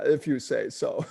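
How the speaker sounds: grave, serious imitation of the doctor; stern deadpan. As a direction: repeat it sternly, unsmiling. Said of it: "he's got this very, uh, stern, grave, sincere".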